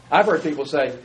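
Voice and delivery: usual speaking voice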